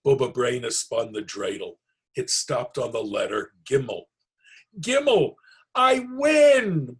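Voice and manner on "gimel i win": much higher and louder, gleeful cry of victory; old woman squeals with delight over her win; excited win. Acted. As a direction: crow about winning the game. Bubba wins at dreidel.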